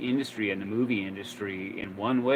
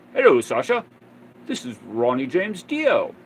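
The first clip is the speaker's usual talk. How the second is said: put-on British accent; 3 semitones higher, 7 dB louder than usual. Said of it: "I think he had a British accent".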